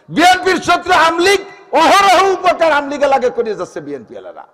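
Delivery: ringing preacher's chant; full-force preaching. Acted. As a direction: call it out to the whole mosque.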